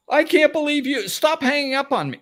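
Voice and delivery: high loud mock fury; furious caller. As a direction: play the caller outraged at being hung up on. Voicing the angry caller.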